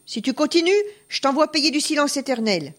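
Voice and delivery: high-pitched, lightly acted menace; mildly shouted threat. A narrator's reading. Farmer threatening to silence the peasant, mildly shouted threat. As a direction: threaten him with restrained anger. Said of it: "cria le fermier curieux".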